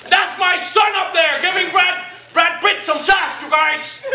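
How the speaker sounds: slow, loud, high proud shout; proud dad shouting. Retold or imitated. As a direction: bellow it to the whole theater. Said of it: "stood up in the middle of the whole movie and went".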